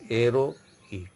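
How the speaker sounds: "héroïque" with silent h, "ero", then "ik"; correct French pronunciation. Shown as a demonstration. Teacher giving correct pronunciation, "héroïque" with silent h. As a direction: separate "ïque" from "héro", no h sound. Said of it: "Your correct pronunciation is".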